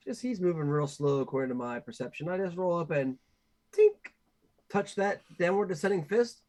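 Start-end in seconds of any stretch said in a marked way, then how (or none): 3.7-4.1 high, sharp little tink noise; imitated tink. Player describing a fingertip touch, high imitated tink. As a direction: make a loud high tapping sound.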